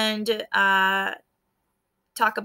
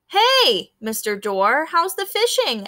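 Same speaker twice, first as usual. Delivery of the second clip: friendly greeting; 5 semitones higher, 4 dB louder than usual. A narrator's reading. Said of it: "asked Chemo".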